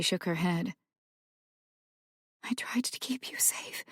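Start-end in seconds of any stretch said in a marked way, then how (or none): 2.4-3.8 soft, broken whisper; mother whispering to her son. Acted. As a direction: breathe it out in defeat. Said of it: "she whispered".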